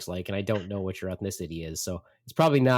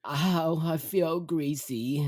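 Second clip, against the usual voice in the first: slow, drawn-out mock misery; moaning mother; 5 semitones higher, much slower than usual. Mother answering dad from the bench, pitiful. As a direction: imitate a mother repeating her pitiful complaint. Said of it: "She then says".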